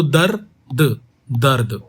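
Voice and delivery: "दर्द" said slowly after तो; slow it down for the class; slow teaching repeat; slow demo. Shown as a demonstration. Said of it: "इसको कैसे बोलेंगे".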